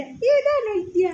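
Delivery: high, very slow, drawn-out question. Real, not acted. Playful question to baby.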